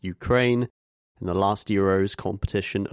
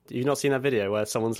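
ordinary delivery

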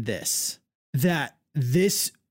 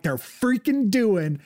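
retold excitement; 5 semitones higher, slower than usual. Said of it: "and I'm like".

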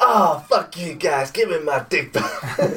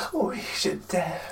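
sultry whisper; 3 semitones higher, 7 dB quieter, slower than usual. Acted. Mock sultry voice.

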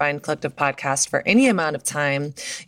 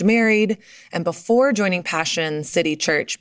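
ordinary talk, nothing marked

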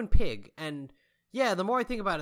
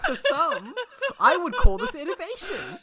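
laughing; 12 semitones higher, 7 dB louder than usual. Real, not acted. Defending joke amid laughter.

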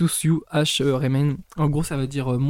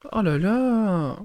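exasperated sigh; 5 semitones higher, much slower than usual. Real, not acted. Exasperated at own misreading.

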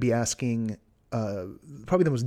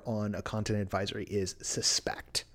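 whispering; 3 semitones lower, 6 dB quieter than usual. Real, not acted. Confidential emphasis to listeners.

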